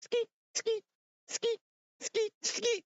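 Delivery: high squeaky "skeet" laugh noises; mimicked giggle. Ghostly buddy laughing at him, squeaky high snicker. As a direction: imitate a sneaky friend snickering high. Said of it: "he's just laughing, going".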